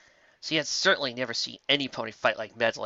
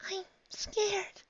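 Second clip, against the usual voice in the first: small, high, soft frightened voice; scared; 8 dB quieter than usual. Acted. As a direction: admit it quietly, afraid. Frightened pony admits fear.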